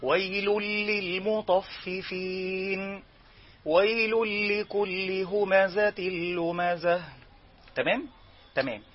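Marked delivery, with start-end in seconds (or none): 0.0-7.0 slow chanted Quran recitation; correct recitation. Shown as a demonstration. Teacher modelling the correct reading, chanted slowly. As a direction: chant it plainly, without leaning. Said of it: "اقرأ عادي بدون أن تركن".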